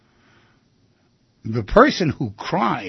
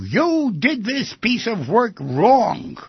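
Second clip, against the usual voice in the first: high, slow mock yell of a boss; acted boss's reprimand, pitched up; 9 semitones higher, 3 dB louder, slower than usual. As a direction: scold like an angry boss, drawn out. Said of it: "but he yells at you".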